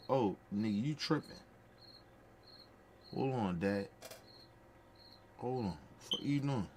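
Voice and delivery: a hint of a little girl calming her upset dad; daughter scolding. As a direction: play the kid telling her father off.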